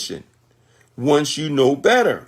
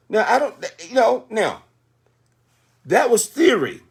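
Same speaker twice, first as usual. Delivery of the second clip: usual speaking voice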